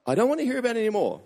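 high, fast fed-up mum; imitated exasperation. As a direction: put on a mother who has had enough. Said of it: "she got sick of it".

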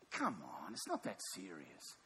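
low, soft brush-off; quiet dismissive wife. Acted. Lot's wife doubting the angel, quietly dismissive. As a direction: make light of it in a dropped voice.

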